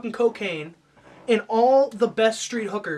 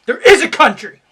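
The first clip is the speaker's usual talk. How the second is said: hammed-up American pride at full volume; patriotic shout; 9 semitones higher, 17 dB louder than usual. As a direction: proclaim it louder with each phrase. Patriotic rant, building.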